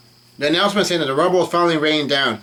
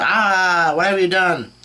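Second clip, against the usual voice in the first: higher-pitched, drawn-out cry of protest; mock yell; 5 semitones higher, 3 dB louder, slower than usual. A narrator's reading. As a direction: read it as Luffy's startled yell. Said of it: "And Luffy's yelling".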